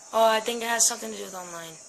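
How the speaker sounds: ordinary talk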